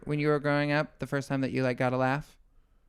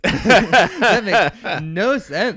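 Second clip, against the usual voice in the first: laughing; 4 semitones higher, 13 dB louder, much slower than usual. Real, not acted. Laughing at an absurd remark.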